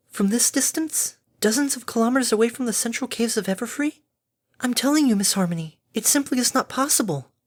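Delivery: high, doubting character voice; incredulous Fluttershy. Acted. Fluttershy doubts Harmony's mana crystal idea, incredulous. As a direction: pitch it high, insisting it cannot be.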